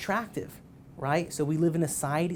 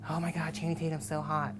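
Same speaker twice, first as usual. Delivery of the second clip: a lightly voiced girlfriend, softer, gushing; imitating girlfriend; 4 dB quieter than usual. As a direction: gush like a fan, mildly mocking. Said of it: "She likes Channing Tatum".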